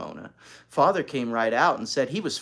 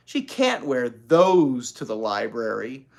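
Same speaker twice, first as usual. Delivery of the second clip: dismayed thought; 6 dB louder, slower than usual. Acted. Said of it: "she thought".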